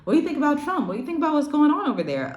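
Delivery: high, fast, put-on voice of a foreigner asking about Trump; curious foreigner. Foreigners quizzing an American about Trump, high and quick. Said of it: "they're like".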